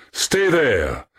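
high call, falling sharply. A narrator's reading. Young man calling as he runs, high call. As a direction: call it back while running off. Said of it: "calling as he ran".

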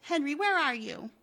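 high, loud call through the house. Acted. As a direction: call out to someone in another room. Wilma calls out for Henry.

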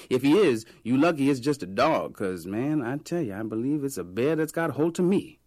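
loud, lively Harlem vendor spinning a joke; joking vendor. A narrator's reading. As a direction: brag it like a street comic. Vendor jokes about the bear.

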